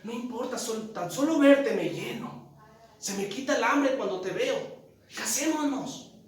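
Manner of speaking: a lightly voiced infatuated young woman; lovestruck girlfriend. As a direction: sweetly coax him to marry. Smitten girlfriend voiced in story.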